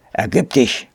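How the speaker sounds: mildly puffed, hoarse order; panting command. A narrator's reading. Head ape demands the boy's surrender, lightly panting. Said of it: "keuchte der Oberaffe".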